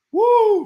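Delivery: high, loud "woo"; sudden piercing whoop; excited whoop. Real, not acted. Host reacting to viewers' messages, excited whoop.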